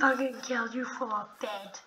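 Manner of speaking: usual speaking voice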